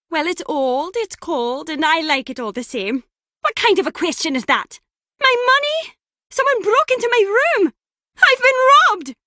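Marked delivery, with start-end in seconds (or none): 5.2-9.1 high, loud shock building to a shout; alarmed outcry. Acted. Discovering the room was robbed, alarmed high shouting. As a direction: cry out, louder as the theft sinks in.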